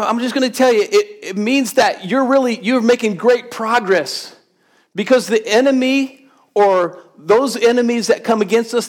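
high, driving preacher's call; preaching at full force. Acted. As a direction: preach it out to the whole room.

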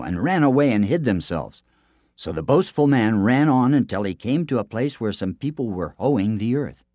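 plain reading voice

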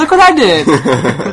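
slow, laughing, proud; laughing proud reveal. Retold or imitated. Walking downstairs with the camera, proud laughing crow.

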